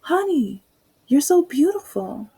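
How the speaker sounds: imitating a cloying online woman; syrupy sweet woman. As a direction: gush with fake sweetness. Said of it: "she was like".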